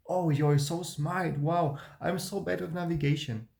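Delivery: mock overeager high-pitched chatter; cringey sugary high voice, hammed up; fake high voice. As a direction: imitate your younger self chirping up high to please. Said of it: "I was talking with this high voice".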